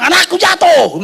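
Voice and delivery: imitating a panicked mother; alarmed cry. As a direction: call out in mock panic for your child. Wife's alarm acted out.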